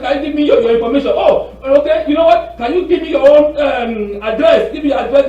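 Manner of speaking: high, loud mock-eager questioning; playing along. Man playing along, asking scammer for details, mock-eager. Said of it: "sometimes I play along with them".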